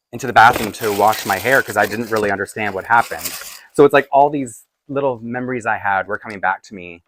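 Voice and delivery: usual speaking voice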